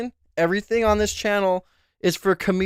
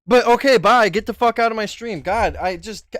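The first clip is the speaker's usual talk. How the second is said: ordinary talk, nothing marked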